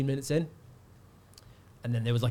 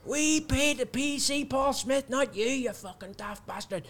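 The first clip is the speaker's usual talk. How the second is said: high, slow mock drunk rant; angry heckler; 12 semitones higher, slower than usual. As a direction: rant like a furious punter. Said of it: "He's going".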